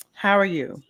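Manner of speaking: ordinary delivery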